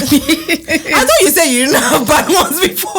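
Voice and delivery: laughing while speaking. Real, not acted. Both laughing.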